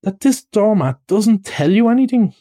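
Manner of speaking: high, incredulous question; worked up, pitched high; excited. Acted. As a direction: press a friend in disbelief. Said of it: "cried the excited rat".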